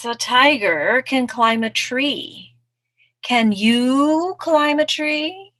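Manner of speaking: slithery slow storybook snake; challenging snake. Acted. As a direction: draw the question out, rising to a taunt.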